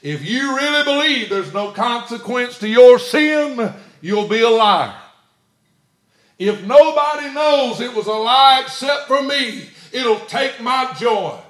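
preaching at full force; fiery preaching. Acted. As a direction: build to a thundering warning.

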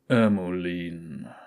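tief, langsam und fremd gerufen; unheimliche Feuerstimme. Acted. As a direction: lock sie wie ein Geist. Unheimliche Stimme aus dem Feuer. (dunkle Geisterstimme)

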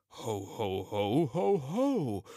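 acted ho-ho-ho, rising as it goes; Santa's laugh. Santa amused by the elf's confession, rising ho-ho-ho laugh. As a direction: chuckle like Santa, each ho climbing.

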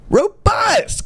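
a high incredulous yelp of "Robust!"; outraged shout. Shown as a demonstration. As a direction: blurt "Robust!" in mock outrage. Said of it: "repeating the ad's word in outrage".

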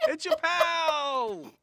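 loud, high, stretched-out singer caricature. Acted. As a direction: holler it slowly as an over-the-top celebrity parody. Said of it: "That's my [name] impression".

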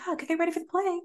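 put-on high, soft old-time drama teacher voice; fifties drama teacher. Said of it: "the drama teacher from, like, nineteen fifty something".

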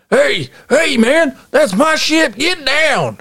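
a Southern yokel claiming the ship; Southern accent. Acted. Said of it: "And they're like".

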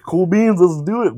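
eager agreement. Retold or imitated. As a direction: jump at the offer. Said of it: "I would've been like".

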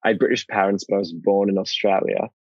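put-on British accent drifting toward Australian; English accent, slightly Australian. Shown as a demonstration. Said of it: "it often ends up sounding slightly Australian".

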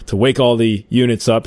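ordinary talk, nothing marked

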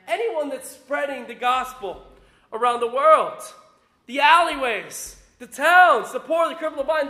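ordinary talk, nothing marked

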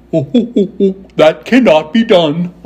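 slow, loud words between monkey hoots; refusing Monkey King. A narrator's reading. Monkey King refusing Dorothy, hooting monkey voice. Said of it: "he said".

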